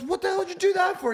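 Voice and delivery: very high, loud outburst; outraged giraffe. Acted. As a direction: yell it in shock. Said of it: "the giraffe goes".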